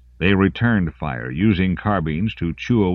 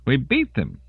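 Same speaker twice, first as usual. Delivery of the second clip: higher, wound-up disbelief; a touch of hysteria in it; hysterical edge; 8 semitones higher than usual. A narrator's reading. Soldier after the firefight, edging on hysterical. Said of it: "Kibbo's voice had a hysterical edge".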